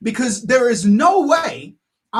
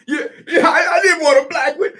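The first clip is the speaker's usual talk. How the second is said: higher voice breaking into laughter; laughing mock dad; 7 semitones higher than usual. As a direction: laugh through the fake relief.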